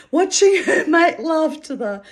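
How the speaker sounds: high, loud, laughing through the words. Real, not acted. Recalling an absurd love scene, laughing. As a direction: crack up while telling it.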